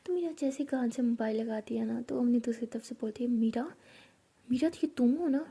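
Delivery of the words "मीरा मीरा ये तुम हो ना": higher, anxious, words tumbling out; worried friend on phone pours out questions, higher and rushed. Acted.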